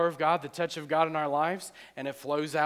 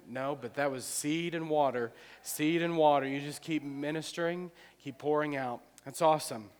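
usual speaking voice

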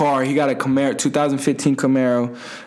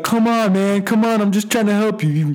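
higher-pitched imitation of a pleading helper; exasperated pleading; 6 semitones higher than usual. Decker begging the drunk friend to cooperate, exasperated.

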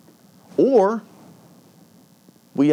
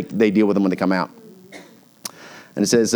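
ordinary talk, nothing marked

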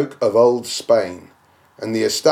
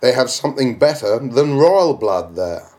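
usual speaking voice

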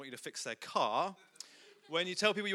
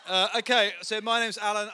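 normal talking voice

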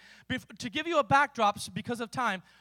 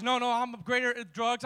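ordinary talk

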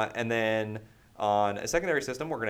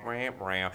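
echoed mock sad-trombone noise, slightly low; wah-wah of playful letdown; 3 semitones lower than usual. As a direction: imitate a losing horn.

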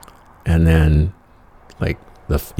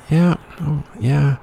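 much higher, awkward forced yeah; awkward agreement; 11 semitones higher, slower than usual. Retold or imitated. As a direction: squirm through the agreement in a high voice. Awkward reply on the spot.

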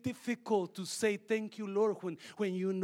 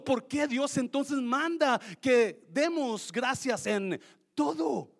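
loud, driving sermon delivery; preaching at full force; 7 semitones higher, 9 dB louder than usual. Acted. Pastor rousing the congregation about gratitude, loud preaching. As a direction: preach it to the back row.

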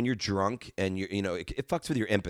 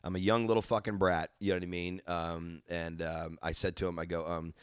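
ordinary talk

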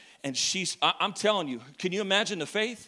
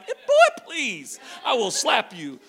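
loud, high, sassy church lady snap; mock indignant shout; sassy retort; 3 semitones higher, 5 dB louder than usual. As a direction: threaten him with a slap, playfully.

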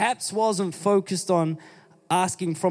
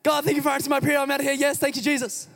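much higher, half-sung happy thanks; gleeful thanks; 8 semitones higher, much faster than usual. Pretend. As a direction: sing out your relief as you run. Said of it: "That's what you would do".

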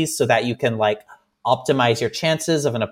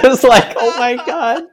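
high, loud words through laughter; real overjoyed laughter in the voice; laughing; 10 semitones higher, 3 dB louder than usual. Real, not acted. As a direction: laugh helplessly while talking. Laughing as she recalls the moment.

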